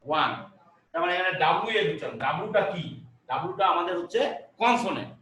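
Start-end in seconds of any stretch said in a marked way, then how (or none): 0.1-0.3 ওয়ান said as a single clipped "wan" with a W glide; saying ওয়ান. Shown as a demonstration. Teacher to class, modelling how ওয়ান sounds. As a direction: demonstrate the sound of ওয়ান for students. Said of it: "বিষয়টা এরকম আসছে উচ্চারণ".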